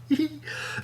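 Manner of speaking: high-pitched soft embarrassed giggle. Real, not acted. Embarrassed thanks to friend.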